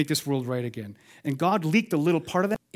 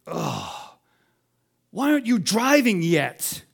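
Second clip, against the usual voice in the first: mock grumble; 4 semitones higher, slower than usual. Mock grumbling parent to child.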